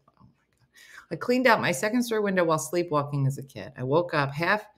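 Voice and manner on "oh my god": unvoiced, slow gasp of shock; whispered. Real, not acted. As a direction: breathe it out in horror. Reacting to painful story.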